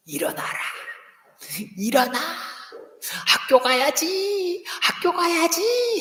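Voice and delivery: high, slow sing-song wake-up call; parent rousing child. Retold or imitated. A parent calling a sleeping child awake, high and slow. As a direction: coax the kid out of bed, high and drawn out.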